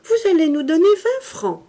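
high, loud faux-innocent demand; naive girl. A narrator's reading. Berthe asking her uncle for twenty francs, high sweet-innocent voice. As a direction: ask for money as if it were nothing. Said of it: "d'un air naïf".

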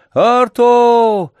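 long, high, loud call; drawn-out shout. A narrator's reading. Boy calling his vanished dog, long drawn-out shout. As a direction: shout the name and hold the last vowel. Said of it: "ещё раз закричал протяжно".

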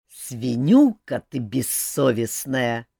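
slow, outraged scolding; indignant. A narrator's reading. Old mage scolding his great-granddaughter, slowly indignant. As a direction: drag out the insult in mild indignation. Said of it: "возмутился Элмар".

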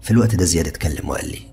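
ordinary delivery

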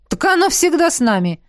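high, light smirking reply. A narrator's reading. Dean explains the ever-present weapons to the heroine, smirking. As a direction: answer with an amused smirk. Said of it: "усмехнулся Дин".

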